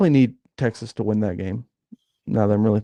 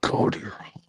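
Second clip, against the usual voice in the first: mock whisper; 7 dB quieter than usual. Cohost mocks the rant.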